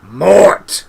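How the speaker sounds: sudden high, very loud snap; vicious one-word yell; whiplash shout. A narrator's reading. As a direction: fire the name out like a whip crack. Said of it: "snapped out as fast as a whiplash".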